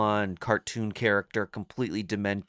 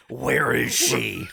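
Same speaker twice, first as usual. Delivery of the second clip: Batman impression; 3 semitones higher, 4 dB louder, slower than usual. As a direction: growl like Batman. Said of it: "he just did his impression".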